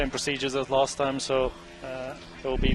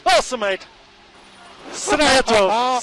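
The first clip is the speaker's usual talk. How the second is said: excited shout; 10 semitones higher, 7 dB louder than usual. Real, not acted. Cheering the podium finish.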